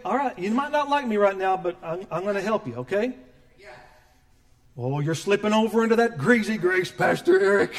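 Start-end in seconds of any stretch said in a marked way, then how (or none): 4.8-7.7 lightly voiced critic, louder; a hint of a scolding churchgoer; mock accuser. Critic accusing Pastor Eric of greasy grace, mild mock scold. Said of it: "I've been accused of all that junk".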